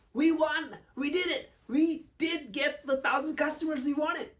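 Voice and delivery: high, loud victory cheer; enthusiastic. Shown as a demonstration. Coach celebrating a thousand customers to show tone, enthusiastic. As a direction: announce a big win, elated. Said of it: "That's enthusiasm tone".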